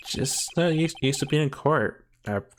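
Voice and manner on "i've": ordinary delivery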